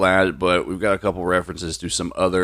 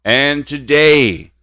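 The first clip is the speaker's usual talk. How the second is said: slow, loud and drawn out; dramatic slow; 6 semitones higher, 8 dB louder, much slower than usual. Real, not acted. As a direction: stretch it for suspense.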